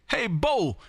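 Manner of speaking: ordinary talk, nothing marked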